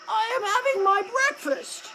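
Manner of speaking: high, muffled snake voice through a mouthful; mouth full. A narrator's reading. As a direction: talk through a swallowed egg. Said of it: "Speaking with his mouth full".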